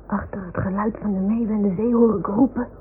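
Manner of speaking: plain reading voice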